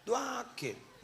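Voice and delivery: doaki said high but soft, falling away; softly coaxing doaki. Shown as a demonstration. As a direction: drop doaki to a quiet prompt.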